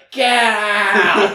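put-on a drunk teddy bear bellowing at intruders; angry shout. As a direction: bear roars out loud and high, hammed up. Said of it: "he's like".

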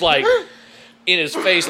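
ordinary delivery

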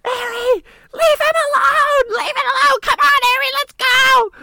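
piercing, very high panicked yell; screaming wife. Retold or imitated. Wife screams at husband to leave the fight, frantic shriek. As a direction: imitate a frantic wife shrieking across the street. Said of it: "screaming at me".

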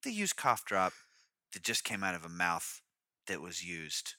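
mock commercial read. Mock cough drop ad tagline.

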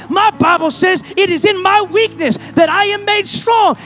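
loud, high preacher's build; fervent revival cry; preaching at full force. Acted. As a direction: drive it home to the congregation.

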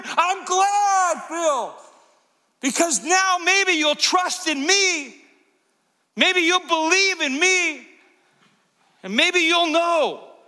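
high-pitched, drawn-out call over a storm; Jesus. Acted. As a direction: holler slowly, stretching each phrase. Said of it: "You will hear the voice of Jesus".

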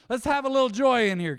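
normal talking voice

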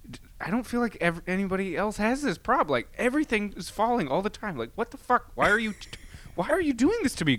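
high, whining complaint to gravity; hammed-up childlike protest; mock aggrieved rant. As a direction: plead with gravity, exasperated.

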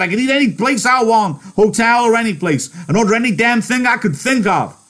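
very high, loud ranting tirade; furious rant. Acted. George raging at Lenny about his lost freedom, furious. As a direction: vent every frustration at him.

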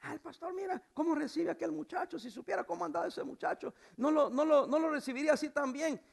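high-pitched mock gossip; put-on high complaining voice; grumbling churchgoer. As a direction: imitate a member criticising the pastor. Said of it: "Así dijo alguien".

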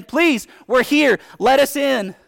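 loud, high, slow pleading; begging. Pretend. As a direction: plead to be let in before the flood. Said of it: "you're begging that man to let you in".

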